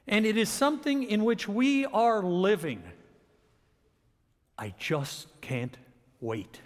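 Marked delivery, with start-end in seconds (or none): 4.6-6.6 low, soft and very slow, word by word; hushed anticipation. Shown as a demonstration. Preacher echoing the phrase about the coming vision, hushed anticipation. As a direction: let "wait" sink softly after a pause.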